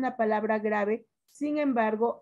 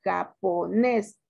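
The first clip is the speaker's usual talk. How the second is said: "Japonés" slowly split as ja, po, NES; stress on last syllable; 3 semitones lower, much slower than usual. Shown as a demonstration. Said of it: "sílaba tónica".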